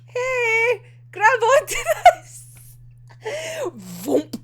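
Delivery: high, bright call, laughing through it; excited Kenji. Acted. Kenji calls to Atagawa mid-air, excited laughing shout. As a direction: shout it like an eager helper. Voicing Kenji offering help.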